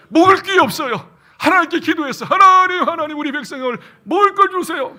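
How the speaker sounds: loud, high crying-out prayer; desperate cry. Retold or imitated. Preacher acting Moses praying for food, loud desperate cry.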